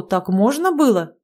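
ordinary delivery

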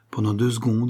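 ordinary delivery, nothing marked

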